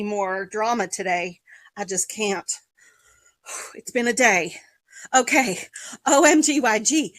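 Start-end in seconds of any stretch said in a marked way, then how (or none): none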